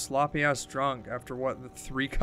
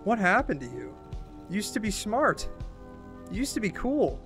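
raised pitch, accusing questions; hurt and angry; 4 semitones higher than usual. Acted. Confronting old friend who forgot her dead mom, bitterly. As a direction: pile up the accusations, each one pointed.

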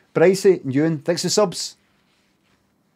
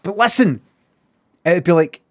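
normal talking voice

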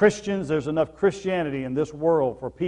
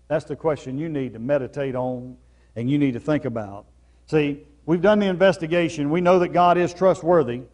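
normal talking voice

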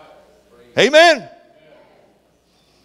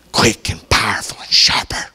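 whisper; 7 semitones lower than usual. Acted. Preacher drops to a whisper.

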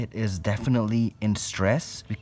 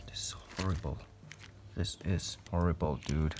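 much softer, hushed despair; quiet whisper of dismay over the spider; real whisper; 5 semitones lower, 9 dB quieter than usual. Real, not acted. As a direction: whisper it under your breath in dismay. Fearing the tea is dead.

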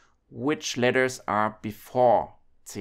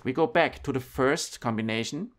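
ordinary talk, nothing marked

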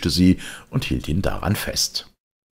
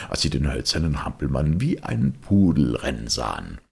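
usual speaking voice

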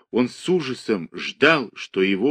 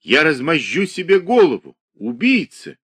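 desperate scream; 6 semitones higher, 4 dB louder than usual. A narrator's reading. Andrei's desperate cries.

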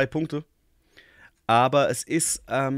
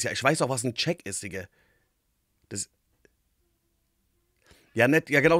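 ordinary talk

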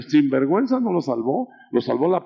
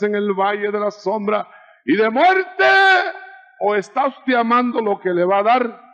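higher, insistent sermon cadence; fervent preaching; 6 semitones higher than usual. Acted. Preacher pressing the congregation in a raised, fervent voice. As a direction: press the question on the church.

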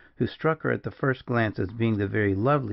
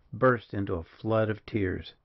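plain reading voice

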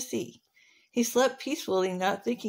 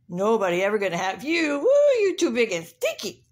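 taunting rooster; 5 dB louder than usual. Acted. Rooster mocking rhino as too big and stinky, jeering. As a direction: taunt with a hooted "Woo". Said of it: "Rooster said".

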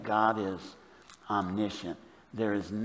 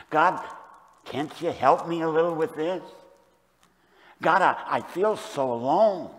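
higher, mildly pleading prayer; understated cry for help, pitched up; lonely plea; 6 semitones higher, 4 dB louder than usual. Acted. Lonely believer asking God for help, mildly pleading.

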